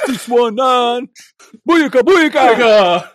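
high, slow shouted catchphrase; heroic rallying shout. Acted. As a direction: chant it like a wrestling crowd. Rey Mysterio catchphrase shouted.

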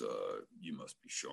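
very low, soft big-man voice; deep bass voice. Retold or imitated. Huge boss greets new hire, deep bass voice. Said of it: "in his deep-ass voice".

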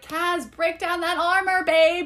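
high, rising cheerleading plea; urging plea. Acted. Reader urging Kaz to drop his armor, pleading encouragement.

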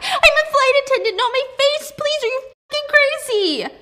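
high, loud terrified shriek; panicked scream. Acted. Mina shrieking at Diana in terror, loud and high. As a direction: scream it as the burning log comes at your face. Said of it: "Mina screams and falls back".

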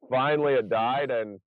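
ordinary talk, nothing marked

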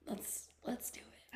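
slow, hushed whisper. Pretend. Co-host agreeing to talk about what's to come, whispering. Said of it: "Let's whisper".

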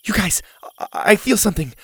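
excited. Acted. Zeta feels the egg move.

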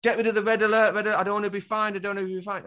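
frantic, rushed pleading; mock panic. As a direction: imitate panicked people begging, fast. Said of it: "panicking and they're going to these".

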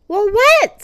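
high, loud and eager. A narrator's reading. Hippo to elephant, eager, high and loud. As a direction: jump in, desperate to know. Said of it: "said the hippopotamus eagerly".

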